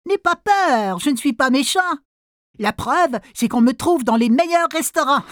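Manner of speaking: high, falsely sweet wolf voice; sly sea wolf. Acted. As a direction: reassure with a sly grin. (loup sournois et mielleux)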